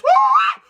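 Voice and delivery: high, loud preacher's whoop; ecstatic woo. Real, not acted. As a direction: whoop it out in fervor.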